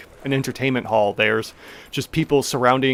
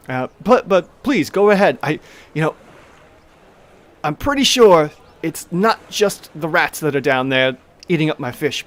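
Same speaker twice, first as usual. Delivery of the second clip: higher halfling voice, polite and urgent; Tamili pleading; 3 semitones higher, 5 dB louder than usual. Acted. Halfling owner pointing down the stairs, asking for help, higher voice. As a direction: ask them nicely for help.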